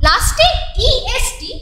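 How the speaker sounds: ordinary delivery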